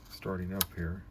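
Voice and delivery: ordinary talk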